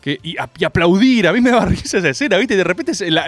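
usual speaking voice

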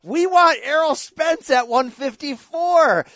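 hamming up the promoter's big claim; mock announcement. Host imitates Eddie Hearn announcing the fight, slow and loud.

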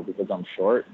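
usual speaking voice

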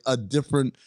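ordinary talk, nothing marked

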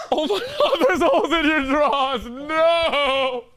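shocked laughing. Real, not acted.

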